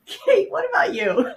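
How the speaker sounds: amused, high-pitched handover; laughing. Real, not acted. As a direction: ask through leftover laughter. Hands over while still laughing.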